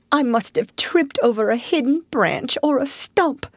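high-pitched, sorry-for-himself complaint; miserable. A narrator's reading. Mole explaining his injury to rat, miserable. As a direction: whimper a little, feeling wretched. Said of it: "said the mole miserably".

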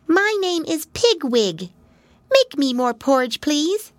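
high, small voice; a tiny girl pig asking sweetly; little pig voice. Acted. Pigwig surprises Pigling, asking for porridge. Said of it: "a little voice spoke".

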